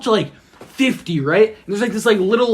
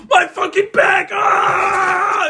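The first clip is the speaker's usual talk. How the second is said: very high hammed-up shriek; mock agony scream; 12 semitones higher, 4 dB louder, much slower than usual. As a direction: yell like a faked crash victim. Acts injured person in hit car.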